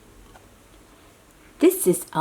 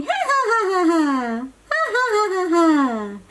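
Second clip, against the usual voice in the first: much higher, giggly puppet laugh; Elmo laughing; 10 semitones higher, faster than usual. Acted. Elmo laughing with the puppies, high giggle. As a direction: bounce a silly high-pitched giggle. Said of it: "Elmo's laughing. He's going".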